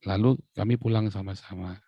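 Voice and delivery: ordinary talk, nothing marked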